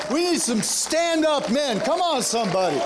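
high, driving preacher cadence; rally the congregation with rising fervor; impassioned call, stretching the key phrases; rousing preaching. Real, not acted. Preacher rallies the men.

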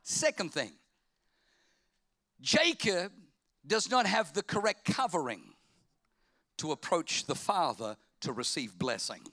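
ordinary talk

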